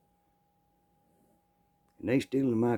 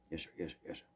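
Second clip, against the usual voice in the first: cowed thief; 5 semitones lower, 16 dB quieter, much faster than usual. Retold or imitated.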